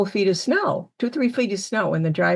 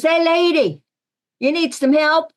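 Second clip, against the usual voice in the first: higher, louder friendly call from a passing driver; truck driver; 8 semitones higher, 4 dB louder than usual. Retold or imitated. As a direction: hail her cheerfully like a plow driver offering help. Truck driver voiced in retelling.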